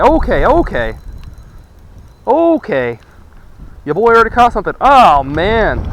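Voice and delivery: loud, thrilled shouting over a big catch; real excitement, voice jumping up; excited. Real, not acted. Fisherman hooks a big bass.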